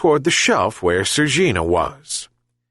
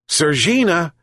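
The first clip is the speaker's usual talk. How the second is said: calling out; 7 semitones higher than usual. A narrator's reading. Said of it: "he shouted again".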